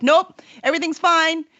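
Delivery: lightly voiced chipper denial; breezy mock order from a pretending community; pretending all is fine. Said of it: "this whole community pretending".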